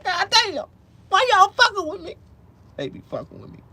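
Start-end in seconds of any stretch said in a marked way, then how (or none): 0.0-2.2 high, loud mock outburst at the ads; exasperated outburst. Seeing implant ads again, high loud complaint. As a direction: yell it up high, fed up. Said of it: "I'm like".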